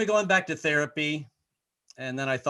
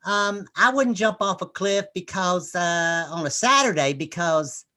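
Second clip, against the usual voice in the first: mom excusing herself; 4 semitones higher, 4 dB louder, slower than usual. Retold or imitated.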